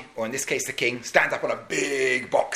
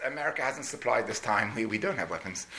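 lower, much softer, sheepish; dejected reply; 6 semitones lower, 8 dB quieter than usual. Retold or imitated. Soldiers telling the king they have no weapons, soft. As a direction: admit it sadly under breath.

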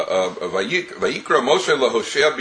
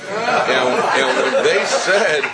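laughing; 7 semitones higher, much slower than usual. Real, not acted. Rabbi laughing at punchline.

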